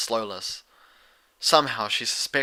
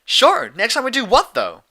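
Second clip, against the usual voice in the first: high, loud, bubbly voice; giggly Pinkie; 12 semitones higher, 9 dB louder than usual. Acted. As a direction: bounce through it with a giggle. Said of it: "Pinkie giggled".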